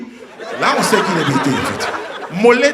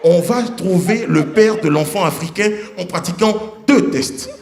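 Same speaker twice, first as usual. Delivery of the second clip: ordinary talk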